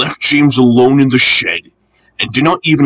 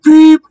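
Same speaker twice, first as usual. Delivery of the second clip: high whistle beep; 8 dB louder than usual. A narrator's reading. Whistle imitated.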